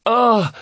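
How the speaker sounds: high startled squeal of fright; frightened squeal. A narrator's reading. Boy hiding under a blanket, frightened squeal. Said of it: "came a frightened squeal".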